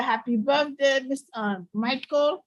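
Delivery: normal talking voice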